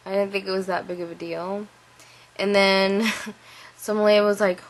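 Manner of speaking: ordinary talk, nothing marked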